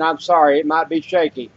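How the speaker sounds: ordinary talk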